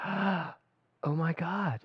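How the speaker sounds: soft, very slow, drawn-out awe; awed gasp. Retold or imitated. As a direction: breathe it out slowly in wonder. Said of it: "you've gone like".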